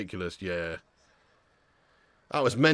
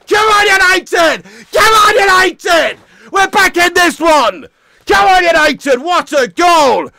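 loud slow chant-like yelling; real fan cheering; rallying shout; 9 semitones higher, 9 dB louder, slower than usual. Real, not acted. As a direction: roar your team on from the sofa. Cheering on team.

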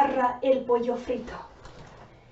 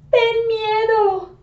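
scared; 10 semitones higher, 7 dB louder, slower than usual. Acted. Acting scared of the monster.

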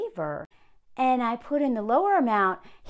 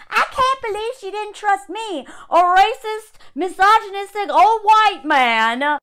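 higher, louder indignant rant; worked up rant; 8 semitones higher, 7 dB louder than usual. Real, not acted. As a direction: rant in real outrage, getting higher and louder. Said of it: "I'm getting really worked up".

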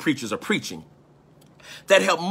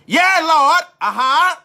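high, loud amen-corner shout; loud church holler, high-pitched; congregant shouting back; 12 semitones higher, 6 dB louder, slower than usual. Retold or imitated. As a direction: call out like a fired-up churchgoer. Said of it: "might say".

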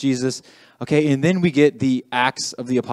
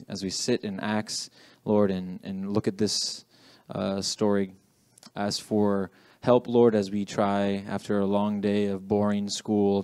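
spoken prayer; 3 semitones lower than usual. Real, not acted. Leader praying for help to focus after school, subdued prayer. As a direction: pray aloud in a subdued voice, pausing to find words.

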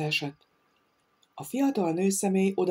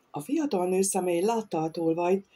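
ordinary delivery

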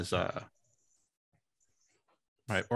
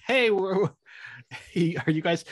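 excited greeting; 9 semitones higher than usual. Retold or imitated. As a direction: greet the neighbors like old pals. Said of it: "You're like".

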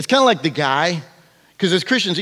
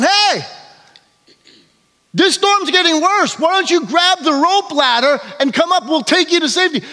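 shouting rescuer; 9 semitones higher than usual. Acted. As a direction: yell over the storm through a megaphone. Helicopter crew shouting down.

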